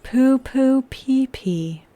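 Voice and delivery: slow robotic monotone. Acted. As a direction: drag it out like a robot. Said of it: "I will say in the robot voice".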